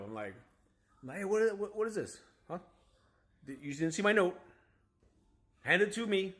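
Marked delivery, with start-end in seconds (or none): 1.1-4.4 retold angry call. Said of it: "So now I'm even more mad". 5.6-6.3 higher, louder hand it to me; mock angry insistence; repeating his demand to the driver with annoyance; retold angry demand. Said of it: "So now I'm even more mad".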